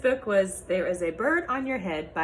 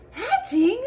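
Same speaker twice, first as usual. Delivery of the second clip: high, loud disbelieving cry; shocked question; 11 dB louder than usual. Acted. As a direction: yelp it in disbelief. Eggs hatching on his head.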